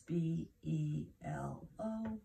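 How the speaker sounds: letters spelled out slowly, one by one; slow spelling. Shown as a demonstration. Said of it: "So let's do this".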